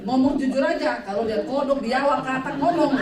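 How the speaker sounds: loud, mock-insistent challenge; loud demand. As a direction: demand it loudly, half in jest. Pressing him to speak honestly.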